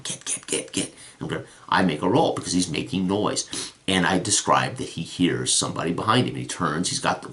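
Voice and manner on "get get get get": breathy urgent whispered get get; whispered shooing. Retold or imitated. GM shooing the horses out of the pen, whispered.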